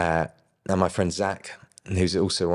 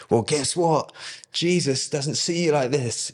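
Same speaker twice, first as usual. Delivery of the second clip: higher, slow preacher voice, retold; a lightly hammed-up stage preacher, higher and slow; imitating the preacher; 9 semitones higher, slower than usual.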